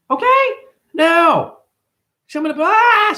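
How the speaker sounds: high, loud excited squeal; imitating a hyped-up customer at the counter; hyper mock customer. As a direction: mimic the hyper woman's pitch.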